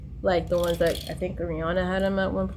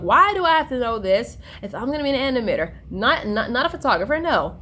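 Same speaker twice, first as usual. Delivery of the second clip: higher, louder mock whine of protest; retold complaining; 6 semitones higher, 4 dB louder than usual. Student griping about class requirements, mock complaining. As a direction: complain with big pitch swoops. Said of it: "like, complaining".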